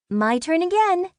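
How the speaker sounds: put-on high, gleeful girl's voice. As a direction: gloat happily over the next turn. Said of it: "Clara said gleefully".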